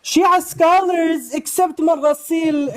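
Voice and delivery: high, drawn-out mock Amrad accent. Debater quoting his opponent to the stream, mocking Amrad accent. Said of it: "in his Amrad accent".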